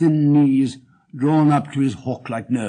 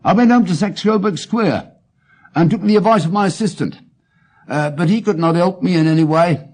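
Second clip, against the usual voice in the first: a higher, louder pleading client voice; anxious client; 5 semitones higher, 5 dB louder than usual. Acted. Wilson pleads his case to Holmes, anxious and raised. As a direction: press your case to the detective, a bit anxious.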